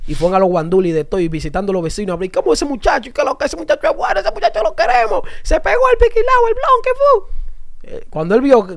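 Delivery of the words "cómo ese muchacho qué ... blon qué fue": mock neighbour gossip. Recalling the neighbours for the interviewer, a mock gossiping voice. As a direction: imitate a nosy neighbour gushing over the boy. Retelling neighbours praising the boy.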